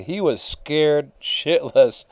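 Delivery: ordinary talk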